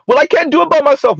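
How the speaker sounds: high, quick mimicry of a complaining woman; put-on high-pitched excuse; mock helpless whine. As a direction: pitch it way up, rushed, mocking her protest. Mimics a woman's protesting reply.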